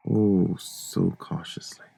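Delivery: hushed, breathless whisper of a stalker. Acted. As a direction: breathe it out, barely audible. Madman narrator creeping into room.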